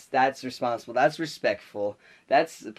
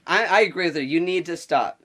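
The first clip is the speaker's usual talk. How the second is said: loud, higher retold objection; emphatic demand; 5 semitones higher, 5 dB louder than usual. Classmate backing the girl against the teacher, emphatic demand. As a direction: speak up firmly, spacing out the demand. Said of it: "I don't think she said it that way".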